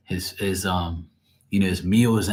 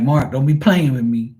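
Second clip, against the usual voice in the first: higher, louder mock warning; imitating her irritation; 5 semitones higher, 6 dB louder than usual. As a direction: playfully mimic an irritated friend. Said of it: "she be getting irritated".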